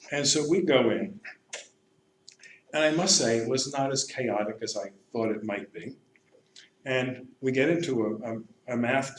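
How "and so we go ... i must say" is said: usual speaking voice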